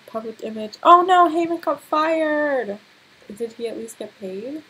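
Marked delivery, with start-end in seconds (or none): 0.8-2.8 loud, high exclamation; big, playful dismay; dismayed outcry. Real, not acted. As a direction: cry out at the bad news. Reacting to bad game news.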